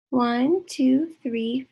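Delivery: normal talking voice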